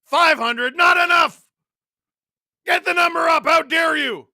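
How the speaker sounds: high, loud mock-indignant shouting; hammed-up outraged yelling; mock outrage. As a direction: scold the viewers in pretend fury. Scolds viewers over like count.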